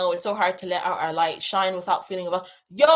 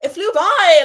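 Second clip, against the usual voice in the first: louder, higher burst of excitement; excited repeat; 8 semitones higher, 11 dB louder, slower than usual. Real, not acted. Streamer marvelling at four hours to her chat. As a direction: belt it out with delight.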